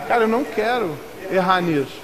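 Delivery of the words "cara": ordinary delivery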